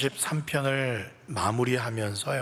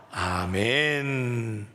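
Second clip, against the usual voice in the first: drawn-out amen; much slower than usual. Real, not acted. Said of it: "한 목소리로 봉독하시겠습니다".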